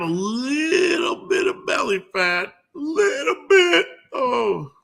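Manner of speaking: very high, drawn-out squeaky voice; mock-straining high squeak; playful squeal. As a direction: squeal it in a silly, tiny voice. Comically squeezing out a small amount.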